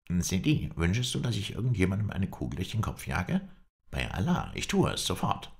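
a hint of a hot-headed little follower; lightly voiced eager servant. A narrator's reading. Halef offers his master to shoot, eager and lightly voiced.

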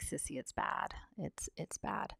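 soft unvoiced whisper, imitating crying sister; tearful whisper. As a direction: whisper it through tears like her sister did. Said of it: "She's just, like, crying".

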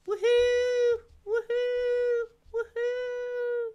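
very high, loud woohoo; loud excited whoop; cheering. Real, not acted. Cheering after give yourself a hand.

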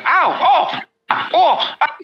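high yelps of pain; short, high ows and ohs; Flipnar. Acted. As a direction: yelp as if being pelted. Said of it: "hear Flipnar like".

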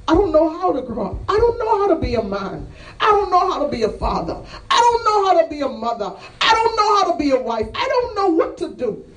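high, crying-out preacher's plea; impassioned confession. Acted. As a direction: build an anguished confession, each line pitched high. Acts out despairing confession of congregation.

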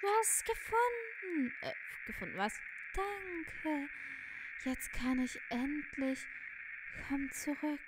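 high-pitched, elongated words; ghostly game figure, grateful. Acted. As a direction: voice an otherworldly figure finding relief.

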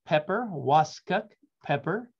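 usual speaking voice

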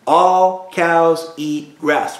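drawn out word by word, very slow; slow teacher chant; very slow mnemonic. Shown as a demonstration. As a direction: stretch each word out for the class. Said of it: "Everybody say that".

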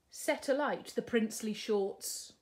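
plain reading voice

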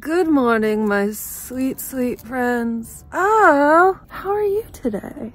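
high, bright sing-song hello; sing-song greeting. Acted.

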